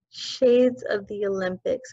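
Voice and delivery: ordinary delivery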